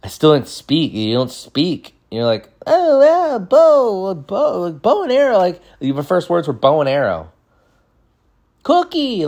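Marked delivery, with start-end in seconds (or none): 2.7-5.4 put-on high-pitched little kid babbling first words; comic high toddler voice; toddler talk. Acting out a kindergartner learning to speak. 8.6-9.3 put-on high little-kid squeak; comic high toddler voice; toddler talk. Acting out a toddler's first word.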